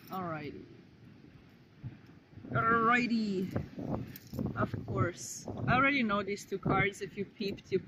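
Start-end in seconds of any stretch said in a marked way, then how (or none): 0.1-0.6 low alrighty. Shown as a demonstration. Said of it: "change of energy". 2.5-3.5 bright alrighty. Shown as a demonstration. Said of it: "change of energy".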